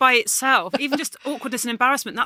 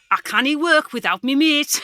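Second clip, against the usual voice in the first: higher, slow Geordie lilt; Ant and Dec Geordie impression; 5 semitones higher, 5 dB louder than usual. Shown as a demonstration. Comedian shows off his Ant and Dec Geordie accent. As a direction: Geordie accent like a telly presenter duo. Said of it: "I'm trying to do an impression of Ant and Dec".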